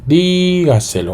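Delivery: teacher showing the stressed syllable; emphatic stress demo. Shown as a demonstration. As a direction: sing out Dígaselo with a high DÍ. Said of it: "el golpe de voz la recibe".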